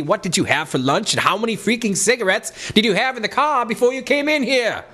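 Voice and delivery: high, strained put-on questioning voice; high mock-indignant interruption; put-on heckler voice. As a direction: butt in with a high, exaggerated voice. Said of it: "There's that voice again".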